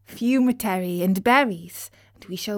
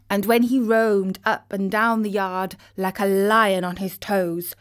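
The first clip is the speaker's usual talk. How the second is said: ordinary delivery, nothing marked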